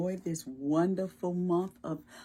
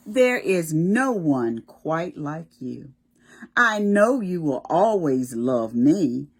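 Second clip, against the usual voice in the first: put-on loud, slow read-aloud to children; storybook reading; 5 dB louder, slower than usual. Teacher reading the book's tender lines to children, loud and slow. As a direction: savour each word like a bedtime picture book. Reads book text aloud.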